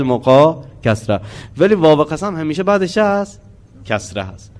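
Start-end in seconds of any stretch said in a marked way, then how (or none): none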